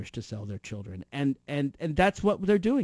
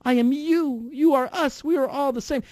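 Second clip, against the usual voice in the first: higher, breathy-free mock earnest plea; mock Christine O'Donnell; 8 semitones higher than usual. Mocking a pundit, a mock earnest higher plea. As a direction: lightly imitate a preachy pundit. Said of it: "sounds a lot like Christine O'Donnell".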